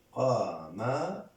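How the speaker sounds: slow, separated qa-ma-ru; syllable by syllable. Shown as a demonstration. Teacher sounding out قمر for learners, slowly by syllable. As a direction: stretch out each syllable of قمر.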